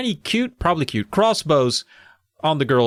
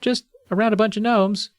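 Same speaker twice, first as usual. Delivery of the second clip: put-on girl voice; 8 semitones higher than usual. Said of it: "they're like".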